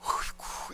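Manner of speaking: imitated breathy groan of exasperation; weary wife's sigh. Preacher imitating his exasperated wife at home, breathy sigh. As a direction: sigh it out under the breath, unvoiced. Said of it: "집사람이 이러고 있더라고요".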